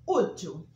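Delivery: útil slow and loud, ú stressed. Shown as a demonstration. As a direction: lean on the ú in útil. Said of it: "acento agudo". (útil com ú tônico)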